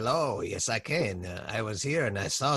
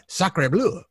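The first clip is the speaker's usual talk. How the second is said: put-on French accent; 7 semitones higher, 3 dB louder than usual. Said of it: "of course, I said".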